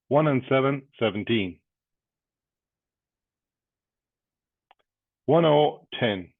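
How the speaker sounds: loud punchy bingo call, number then total; bingo caller. Acted.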